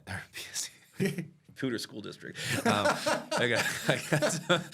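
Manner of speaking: laughing through the words; giggling at his own slip. Real, not acted.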